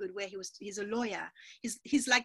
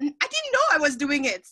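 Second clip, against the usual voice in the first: surprised protest; 4 semitones higher, 8 dB louder than usual. Retold or imitated. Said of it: "And I was like".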